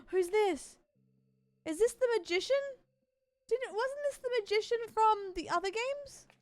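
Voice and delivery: high, bright-eyed surprise at a favorite; excited surprise. Real, not acted. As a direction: gasp and light up with recognition. Gasps at familiar character.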